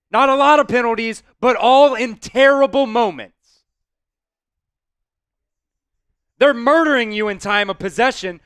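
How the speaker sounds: loud, heated fan rant at his team; real anger, pushed out loud; angry rant. Real, not acted. Fuming fan ranting at the team over the loss, loud and angry. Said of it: "I'm pissed off that we're losing".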